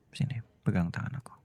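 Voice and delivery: soft whispered offer; tender whisper. Acted. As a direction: hold out your hand with a whisper. Husband offering his hand. (bisikan lembut menawarkan tangan)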